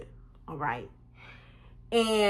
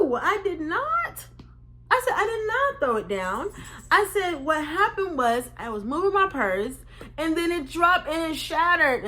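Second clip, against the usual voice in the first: high, indignant denial; defensive insistence; 7 semitones higher than usual. Retold or imitated. She insists to the crew guy she dropped it by accident, high and indignant. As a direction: protest your innocence.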